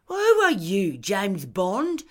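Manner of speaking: high, loud scornful question; mocking. A narrator's reading. Anne scoffs at Lindy's question about alarm, mocking.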